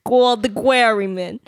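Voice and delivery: put-on Jersey accent, Quald the Quarrymen. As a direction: drop into New Jersey for Quald. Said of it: "My Jersey accent".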